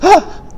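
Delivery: sharp little gasp of wonder; storyteller gasps for the chicken; high delighted gasp. A narrator's reading. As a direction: gasp as if spotting treasure. Chicken finds the book.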